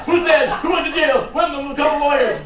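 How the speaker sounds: high, loud panicked shouting; mock panic. Answering a five a.m. phone call, panicked yelling. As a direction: yell frantic questions down the phone.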